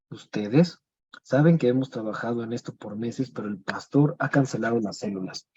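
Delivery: normal talking voice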